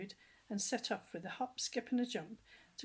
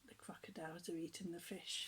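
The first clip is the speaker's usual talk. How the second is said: soft whispered aside; hushed whisper; 4 semitones lower, 10 dB quieter than usual. Real, not acted. Reader whispers to the child about the crocodiles. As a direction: whisper it like a secret.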